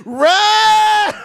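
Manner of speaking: high, loud shouted run; yelled warning. Retold or imitated. Old lady in the back yells at the comic, high and loud.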